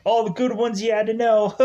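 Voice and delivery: high, loud and quick mock delivery; quoted movie line. Quoting a line from the second film, high and fast. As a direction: rattle it off in a put-on high voice. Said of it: "it's like".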